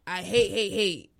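usual speaking voice

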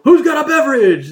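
high, loud cartoonish party guy; mock party guest asking for a drink. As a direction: call out to the room like a goofy party crasher. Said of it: "he'd be like".

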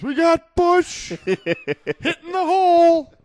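high, loud, drawn-out holler; imitated fan scream. Imitating his buddy cheering a running back, screamed holler. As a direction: scream it like a rowdy football fan.

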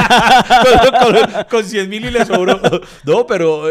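loud, high words through real laughter; laughing hard. Real, not acted. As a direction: cackle through the joke about the driver. Se burla del taxista riendo. (riéndose a carcajadas, más alto y más fuerte)

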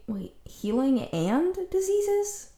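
high, slow double-take; suddenly puzzled, pitch jumping up; surprised. Real, not acted. Sudden doubt listing Apollo's domains.

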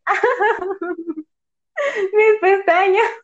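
high, half-laughing fake sob; mock crying. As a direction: wail it as if heartbroken, playfully. Mock grief over eyelashes.

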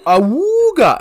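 high loud cartoon honk; cartoon awooga. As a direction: whoop it like a cartoon wolf. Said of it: "and I go".

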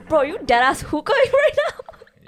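loud, high, drawn-out mock disbelief; hammed-up incredulous yell breaking into laughter; exasperated outburst. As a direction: call a friend out, loud and slow. Acts out exasperated retort to friend.

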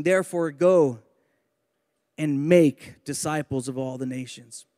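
low-pitched, deliberate verse reading; scripture reading. Acted. Preacher rereading verse nineteen to the church, deliberate low scripture reading. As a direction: reread the verse with pauses, letting "make" stand out.

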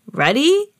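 bright-spirited high rising question; cheerful dad rallying the family; cheery. A narrator's reading. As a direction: ask cheerily, sweeping up in pitch. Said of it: "asked Daddy Pig cheerily".

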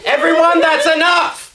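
angry shout. Acted. Father shouts to everyone.

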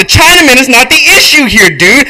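high, loud, furious tirade; acted blowhard outburst; ranting shout. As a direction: shout him down and hammer the point. Walter rants back in script.

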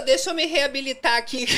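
usual speaking voice

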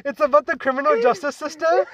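put-on high, perky accented classmate; cute girly voice. Classmate answering in class, imitated as cute and perky. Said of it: "her, like, girly voice".

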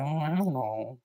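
small, soft reply to dad; hushed, noncommittal kid's answer; imitated meek answer. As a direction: voice your younger self dodging the question quietly. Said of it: "I said it like that".

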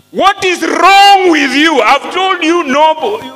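high, loud, slow "what is wrong with you"; exasperated outburst. Retold or imitated. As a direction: blast it like a man fed up. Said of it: "He's saying".

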